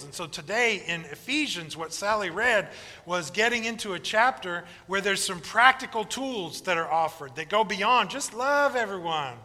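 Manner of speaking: usual speaking voice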